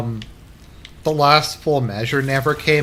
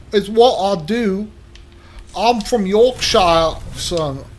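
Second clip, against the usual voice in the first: higher, drawn-out mock Yorkshire accent; British accent attempt; 7 semitones higher, slower than usual. Imitating an audiobook character, attempted British accent. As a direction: put on a slow, hammy Yorkshire accent. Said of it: "It's some British accent".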